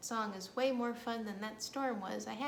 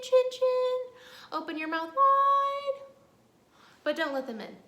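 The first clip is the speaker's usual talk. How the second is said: put-on action rhyme for kids; 8 dB louder than usual. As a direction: chant it, growing louder to the chin.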